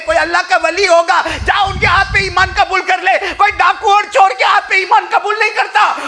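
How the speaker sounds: high, loud impassioned preaching; preaching at full force. Acted. Preacher telling the congregation the story, in loud impassioned preaching. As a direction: call it out to the crowd with fervour.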